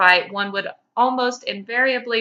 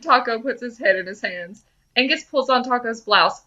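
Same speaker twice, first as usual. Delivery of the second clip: ordinary delivery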